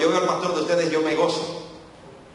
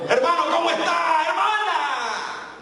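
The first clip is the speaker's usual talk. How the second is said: high, drawn-out cheerful greeting; excited greeting; 9 semitones higher, slower than usual. Retold or imitated. Greeting a fellow church member with excitement, high and drawn out. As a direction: greet them with big, high enthusiasm. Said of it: "lo saluda con tanta emoción".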